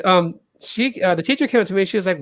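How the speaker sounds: normal talking voice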